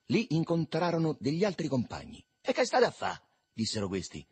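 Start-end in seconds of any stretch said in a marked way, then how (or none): 2.4-3.2 high, loud street hail; raised, high-pitched boyish call; called-out question. Acted. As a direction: hail your mates with the question. Boys hail their companions.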